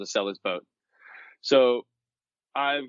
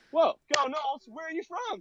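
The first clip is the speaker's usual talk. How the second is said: high, delighted cheer; light up at a fellow fan; squeaky high-pitched surprised hello; excited greeting; 4 dB quieter than usual. Retold or imitated. Greeting the stranger in FSU shirt.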